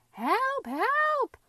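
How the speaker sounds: very high, loud squealed cry; bawling. A narrator's reading. Captured potato to his friends, high bawl. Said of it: "bawled Potato".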